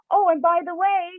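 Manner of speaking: high, chirpy imitation of a lady; cheerful farewell. Storyteller imitates lady at the door leaving, cheerful farewell. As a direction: sing out a happy goodbye, high and light. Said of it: "And she giggles".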